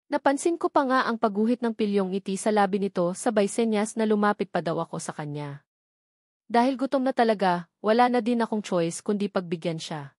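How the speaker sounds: usual speaking voice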